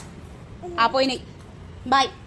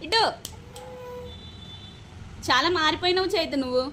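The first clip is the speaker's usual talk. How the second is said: normal talking voice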